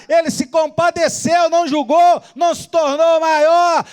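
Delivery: high, sustained fervent delivery; impassioned pulpit crescendo; full-force preaching. Real, not acted. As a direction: build a list of deeds at full pitch.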